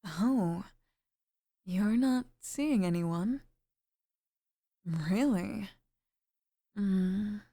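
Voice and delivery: usual speaking voice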